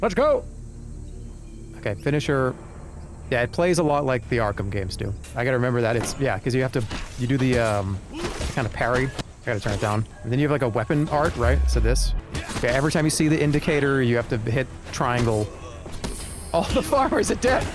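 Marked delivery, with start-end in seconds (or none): 0.0-0.4 high excited cheer. Real, not acted. Excited shout during fight. 16.5-17.7 high, alarmed cry; dismayed outburst. Real, not acted. Alarmed exclamation at failure.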